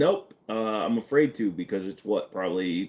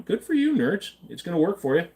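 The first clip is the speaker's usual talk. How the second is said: mock cheer; 10 semitones higher, 4 dB louder than usual. Teasing chat in mock voice.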